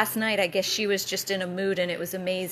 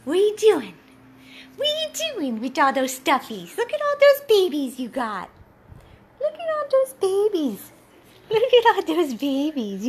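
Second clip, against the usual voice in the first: delighted pet talk; 7 semitones higher, 6 dB louder than usual. Real, not acted. Owner admiring all her dog's stuffed toys, delighted baby talk. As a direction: gush over a dog's toys in a high singsong.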